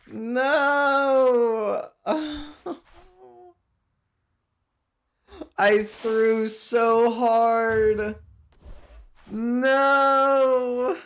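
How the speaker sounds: long, high howl of defeat; anguished wail. Real, not acted. Gamer mourning a thrown game, anguished wail. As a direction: drag each word out high and mournful.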